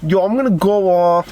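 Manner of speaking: high-pitched, hammed-up Jersey accent; exaggerated New Jersey "yo" voice; put-on Jersey accent. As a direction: make the pick like a mock Jersey guy. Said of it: "the best Jersey accent".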